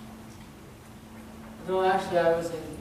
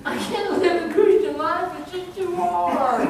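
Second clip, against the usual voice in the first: whiny complaint; 8 semitones higher than usual. Retold or imitated. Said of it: "congregation's imagined voice".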